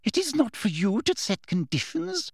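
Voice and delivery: high-pitched, severe reprimand; harsh. A narrator's reading. Umbridge overruling Hermione, harsh. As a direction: rebuke her with authority. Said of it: "said Professor Umbridge harshly".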